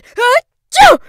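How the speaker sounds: huge high achoo; explosive sneeze; loud sneeze. A narrator's reading. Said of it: "odd sort of punctuation".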